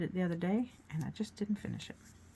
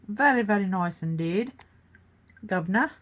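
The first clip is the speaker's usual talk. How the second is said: loud, hammed-up posh British accent; 3 semitones higher, 8 dB louder than usual. Host praises her painting in a posh British accent. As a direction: play a jolly posh Brit, "noice" and all. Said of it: "I make a lot of accents".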